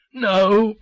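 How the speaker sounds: a despairing loud No; despairing cry. A narrator's reading. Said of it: "The cry was despairing".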